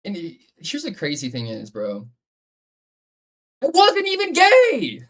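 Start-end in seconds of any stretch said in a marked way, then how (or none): 3.6-5.0 high, slow mock-outraged yell; shouted punchline. Real, not acted. Friend telling a story, squealing the punchline. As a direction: belt it out in a squeaky high shriek.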